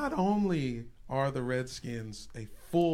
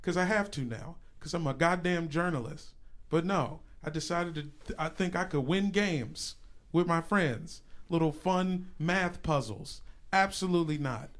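normal talking voice

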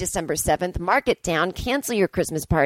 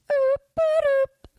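high silly oop boop; silly squeak. Pretend. Marking her own joke, high goofy squeak. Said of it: "funny joke".

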